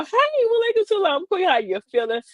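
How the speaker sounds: very high-pitched hello, easing down at the end; acted gushing greeting. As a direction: gush a playful hello to the ladies, way up high. Acting out greeting to the ladies.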